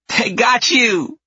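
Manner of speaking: high, slow, loud gleeful cry; excited little girl. A narrator's reading. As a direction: crow with delight like a kid. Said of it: "the tiny one exclaims".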